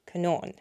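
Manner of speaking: CONAN said softly with a long "aw" second syllable; long fada vowel. Shown as a demonstration. Learner practising Irish long vowels to self, soft "co-NAWN" Conan. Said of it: "I need to work on my Irish long vowels".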